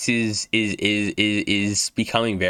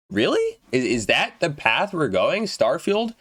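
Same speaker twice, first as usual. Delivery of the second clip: incredulous; 6 semitones higher than usual. Real, not acted. Incredulous reaction to console bias.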